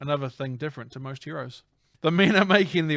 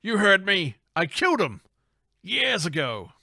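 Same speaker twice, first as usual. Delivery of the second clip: higher, hammed-up insistence; villain boss; 6 semitones higher, 4 dB louder than usual. Boss to startled henchman in the truck, insistent. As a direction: insist in a raised cartoon-villain voice.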